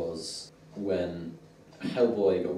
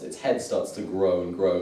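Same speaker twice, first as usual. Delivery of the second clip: ordinary talk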